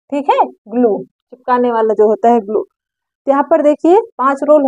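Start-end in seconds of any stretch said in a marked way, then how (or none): none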